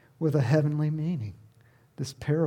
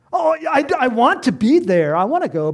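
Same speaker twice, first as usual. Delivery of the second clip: eager yes; 7 semitones higher, 7 dB louder than usual. Retold or imitated. Eager half-hearted yes.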